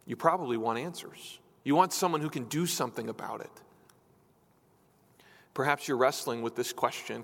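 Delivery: ordinary talk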